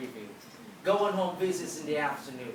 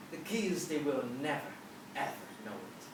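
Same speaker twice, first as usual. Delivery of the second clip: hushed confiding; 4 semitones lower, 7 dB quieter than usual. Real, not acted. Confiding a secret to the audience.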